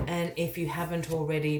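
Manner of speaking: ordinary talk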